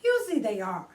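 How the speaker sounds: ordinary delivery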